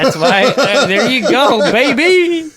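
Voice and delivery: loud, high celebratory shout; gleeful cheer. Real, not acted.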